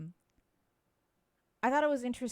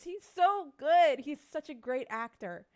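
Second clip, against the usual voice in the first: drawn-out, higher, louder gush of admiration; slow, high-pitched fangirl gush; gushing praise; 6 semitones higher, 4 dB louder, slower than usual. Real, not acted. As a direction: swoon over the actor's performance. Gushing over the actor.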